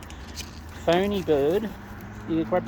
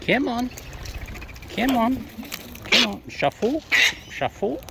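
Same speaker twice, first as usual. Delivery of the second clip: sing-song come on, pitched high; high coaxing calls; 8 semitones higher than usual. Real, not acted. Coaxing the birds to shuffle closer, high and sing-song. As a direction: lure them in with a high bright voice.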